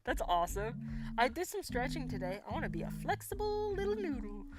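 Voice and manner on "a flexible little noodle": goofy sing-song pitched up; playful silly voice. Pretend. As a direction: stretch it out high like a wobbly noodle. Playful wish about stretching.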